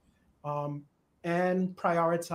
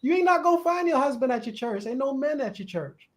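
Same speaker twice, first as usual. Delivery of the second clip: excited preaching; 6 semitones higher, 7 dB louder than usual. Real, not acted. Preaching about single people.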